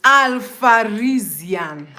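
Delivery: "Alfarizian" slowly, syllable by syllable; careful pronunciation. Shown as a demonstration. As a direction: draw out "Alfarizian" to get every sound right. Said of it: "for me to pronounce the name very well".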